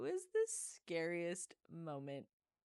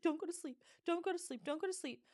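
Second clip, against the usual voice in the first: high, louder desperate pleading, retold; frantic pleading; 11 semitones higher, 4 dB louder than usual. Owner begging the sick foster puppy not to sleep, high frantic plea. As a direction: beg the puppy to stay awake, half-replayed panic.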